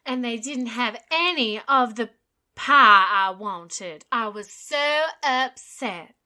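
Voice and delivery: hammed-up American Southern Belle, slow; Southern Belle accent. Co-host puts on a Southern Belle accent, slow. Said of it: "I can only do an American accent if I do, like, the Southern Belle shit".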